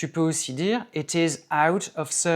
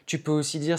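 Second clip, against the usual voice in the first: usual speaking voice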